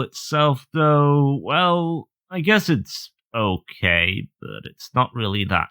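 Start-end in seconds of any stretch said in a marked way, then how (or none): none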